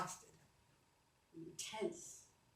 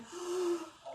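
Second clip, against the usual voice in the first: deep gasp in; 7 semitones higher, 5 dB quieter than usual. Shown as a demonstration. Coach breathes in deeply before the exhale. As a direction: fill your lungs fast. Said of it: "Big breath and".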